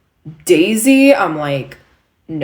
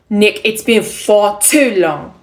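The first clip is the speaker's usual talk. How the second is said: put-on high, loud, slow New York accent; raised New York stage voice; 4 semitones higher, 6 dB louder, slower than usual. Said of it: "speaking with a New York accent".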